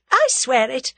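exasperated, firm assurance; impatient. A narrator's reading. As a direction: swear it just to get it over with. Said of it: "said the other impatiently".

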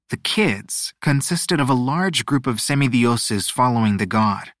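ordinary delivery, nothing marked

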